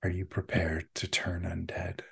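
soft whispered, ominous question; hushed, sinister stranger on the line; creepy caller. Acted. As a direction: whisper the offer eerily down the phone. Creepy caller makes second offer.

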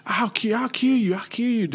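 high put-on drunk threat; drunk girl threat. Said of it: "the girl's just like".

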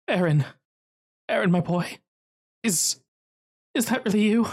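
a father seeing his lost son, voice rising in disbelief; stunned father. Acted. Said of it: "with a shaky hand".

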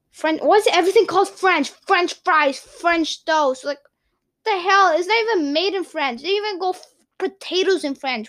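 high, indignant rant; mock outrage. Real, not acted. Vlogger rants to viewers about French names, mock outrage. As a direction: act baffled and outraged.

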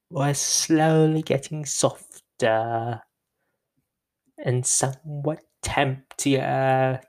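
slow, drawn-out teenager impression; mock teenager. Solo host mocking how they sound, slow teenager voice. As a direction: stretch the words like a put-on teenager. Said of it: "a teenager".

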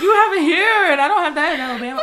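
loud, high, fast laughing complaint; laughing tease to a friend; laughing protest. Real, not acted.

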